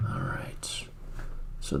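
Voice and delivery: barely audible, breath-only aside; whispered to self. Real, not acted. Streamer looking over the game map, whispered to self. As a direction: whisper it as you get your bearings.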